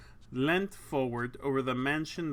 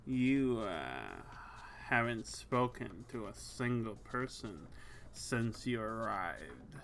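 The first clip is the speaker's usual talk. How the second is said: low, slow, teasing woman; sultry lady voice; 5 semitones lower, 7 dB quieter than usual. Acted.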